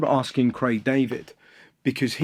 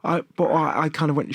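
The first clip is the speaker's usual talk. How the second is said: normal talking voice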